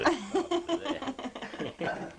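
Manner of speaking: high, slow words broken up by laughter; laughing. Real, not acted. Grandpa joking about the camera, laughing as he speaks. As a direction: laugh through the joke.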